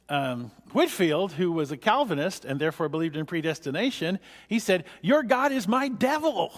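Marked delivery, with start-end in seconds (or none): 5.0-6.5 pitched higher, a retold jab of indignation; mock indignant retort. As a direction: fire it back as a lightly acted rebuke. Wesley retorts in heated debate.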